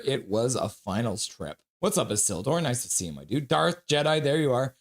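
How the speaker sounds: ordinary talk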